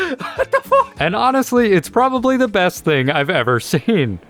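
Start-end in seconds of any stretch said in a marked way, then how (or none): 0.0-0.9 high, slow, cracking with laughter; laughing disbelief. Real, not acted. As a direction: laugh it out, stunned. Repeats, laughing.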